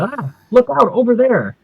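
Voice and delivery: high-pitched comic cry of alarm; alarmed warning. Acted. Spaceman warns the others on seeing a vampire, alarmed.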